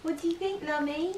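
pet talk. Real, not acted. Talking to the pet dog.

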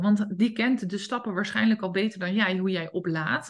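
ordinary talk

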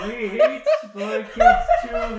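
very high, very loud hammed-up wail; mock-horrified scream. Friend hearing Spy Kids Two referenced, mock-horrified scream. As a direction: scream in mock outrage, laughing.

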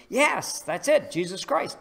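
leaps high and loud on "Yes"; eager. Acted. Alien catching the farmer's answer, eager and high. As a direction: burst in with delighted recognition.

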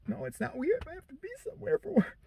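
high, quiet, pained whimper; mock anguish. Man turns down two women because of work, in mock anguish. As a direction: squeeze it out small and high, as if it hurts to say no.

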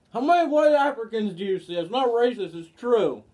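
loud protest. Real, not acted. Defends joke loudly.

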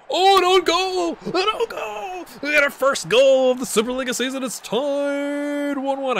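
loud, high-pitched celebration; real goal-celebration yell; excited goal shout. Real, not acted. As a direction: yell it out with drawn-out, soaring vowels. Celebrating an own goal while gaming.

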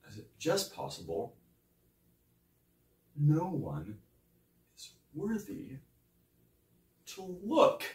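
very soft, with long pauses; hushed, cutting questioning; quiet challenge. Acted. Quiet challenge after the tirade.

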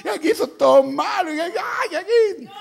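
very high, loud screamed wail; hammed-up hysterical child begging for rescue; frantic pleading. Son recognizing his mother, screaming to be taken out.

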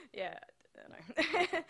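laughing through the words; slow, laughing, high. Real, not acted. Laughing at the ambulance image.

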